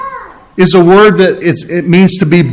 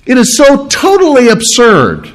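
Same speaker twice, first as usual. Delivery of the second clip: high, emphatic incredulity; emotional disbelief; 6 semitones higher than usual. Acted. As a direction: dismiss it with TOTALLY stretched in disbelief. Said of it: "a lot of emotion put behind that".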